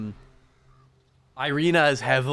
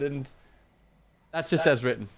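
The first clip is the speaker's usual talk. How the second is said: ordinary talk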